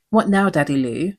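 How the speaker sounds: ordinary talk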